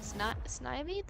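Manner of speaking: slow, doubtful Snivy as "SNY-vee?"; Snivy with a long "eye" sound; long-i pronunciation. Shown as a demonstration. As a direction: say Snivy like "sniper", unsure. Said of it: "That sounds really weird".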